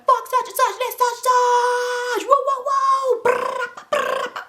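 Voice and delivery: high, loud shouts into trilled noises; mock hype shouting. Men jumping in the car yelling, high mock shouting. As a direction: hoot it out like a rowdy carload.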